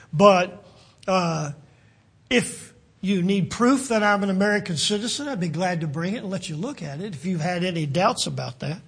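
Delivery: ordinary delivery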